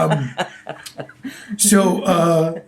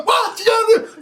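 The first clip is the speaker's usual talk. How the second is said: much higher mock crowd shout. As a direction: yell it back like a heckling crowd. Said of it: "the crowd would go".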